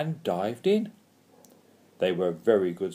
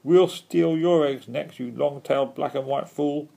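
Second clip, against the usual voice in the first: raised, loud taunting; gloating heckle in a higher voice; jeering; 5 semitones higher, 5 dB louder than usual. A narrator's reading. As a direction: jeer with a mocking ha ha. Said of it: "The Pooks jeered at him too".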